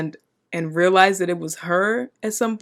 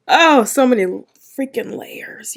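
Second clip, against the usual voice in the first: loud high burst dropping into a whisper; amazed exclamation collapsing to a whisper; overwhelmed outburst; 6 semitones higher, 5 dB louder than usual. Real, not acted. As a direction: start big and high, then lose the voice. Overwhelmed by the episode.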